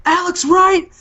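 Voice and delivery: Ric Flair. Retold or imitated. Ric Flair about to fire Alex Wright, high loud outburst. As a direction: cut off mid-yell like Flair. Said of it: "And he goes".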